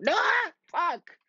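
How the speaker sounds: high, loud frustrated shout; loud cry of defeat; angry yell. Real, not acted. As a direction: blurt it out in sudden rage. Losing game.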